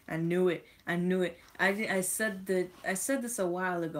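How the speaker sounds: loud, gleeful I knew it; excited gloating; triumphant. Real, not acted. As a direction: crow about being right. Proved right about France.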